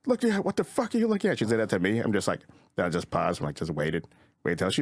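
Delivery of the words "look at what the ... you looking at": high-pitched imitation of a yelling bride; mock yelling. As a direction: yell it in a much higher voice, no extra volume. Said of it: "she's yelling at".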